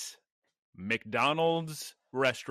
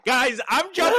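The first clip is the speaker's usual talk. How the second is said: loud; 11 semitones higher, 6 dB louder than usual. Real, not acted. Cutting off laughing co-host.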